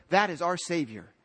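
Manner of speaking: ordinary talk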